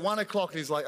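ordinary delivery

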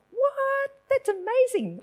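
high-pitched astonished "what"; amazed. Retold or imitated. Woman recalls her reaction to mother giving her life to Christ, amazed.

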